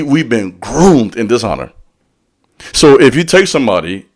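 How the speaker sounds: ordinary talk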